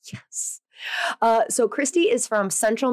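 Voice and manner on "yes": breathy whispered yes of delight; a secret little victory whisper. Retold or imitated. Meeting fun people, a whispered yes of delight.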